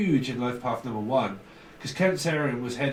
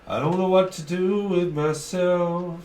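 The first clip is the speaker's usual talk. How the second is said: slow, higher, louder put-on whine; mock whiny despair; 4 semitones higher, 4 dB louder than usual. Shown as a demonstration. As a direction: ham up the helpless moaning, drawn out. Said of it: "plain take before it".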